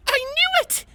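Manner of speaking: very high excited squeal. A narrator's reading. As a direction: squeal it like a starstruck fan. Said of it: "The unicorn suddenly squealed".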